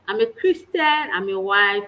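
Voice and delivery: ordinary delivery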